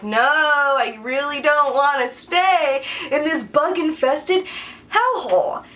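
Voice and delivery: slow, rising mock-tearful whine; imitating whiny protest. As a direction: drag it out in hammed-up misery. Acts out refusing to stay.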